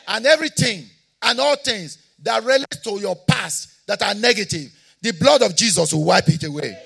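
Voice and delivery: loud, high, shouted declarations to the church; preaching at full force. Real, not acted. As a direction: preach it out with rising fire.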